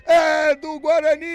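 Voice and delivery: huge held goal shout; explosive goal commentary. Real, not acted. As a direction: belt it out at full power. Goal call after long hold. (grito de gol explosivo)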